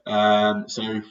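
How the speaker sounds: ordinary talk, nothing marked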